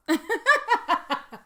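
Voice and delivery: high, loud burst of laughter; real laugh. Real, not acted. Speaker recalls manager's clueless remark, big real laugh.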